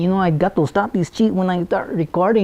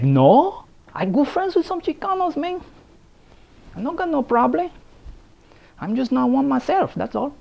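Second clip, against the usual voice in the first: put-on Chicano accent; 7 semitones higher than usual. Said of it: "I like".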